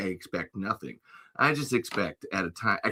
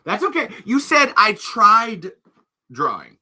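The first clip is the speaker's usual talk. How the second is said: high excited; 11 semitones higher, 8 dB louder, slower than usual. Real, not acted. Excited quoting back.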